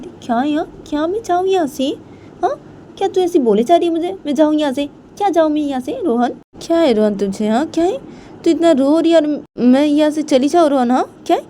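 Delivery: high, loud, agitated questions; indignant protest. Acted. Payal refuses to leave crying Rohan, indignant. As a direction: protest hotly with the pitch right up.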